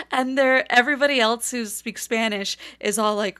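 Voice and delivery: ordinary talk, nothing marked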